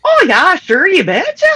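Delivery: sing-song Minnesota accent done properly. Shown as a demonstration. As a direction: show him how a real Minnesotan says it. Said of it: "You didn't say it right".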